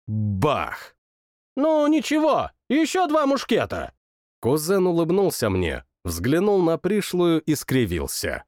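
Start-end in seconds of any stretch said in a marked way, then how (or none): 1.6-3.9 high, forced royal cheer; bravado. Acted. King brushes off his miss, high bravado.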